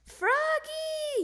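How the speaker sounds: very high, loud, long-held name; yelling. A narrator's reading. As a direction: yell for a child to stop. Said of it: "yelled Dad".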